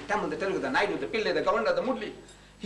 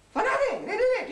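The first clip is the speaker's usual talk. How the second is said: indignant outcry. Acted. Preacher protesting the monkeys slaved unpaid, indignant high outcry. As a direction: fling it up high in disbelief.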